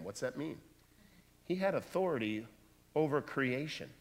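normal talking voice

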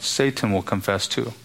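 ordinary delivery

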